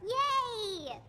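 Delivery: high mock-enthusiastic cheer; fake cheer. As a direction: draw out a falsely happy cry. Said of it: "I meant meh".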